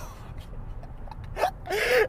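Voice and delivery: high breathy burst of real laughter. Real, not acted. Laughing at herself while telling the phone story. Laughs at own purple question.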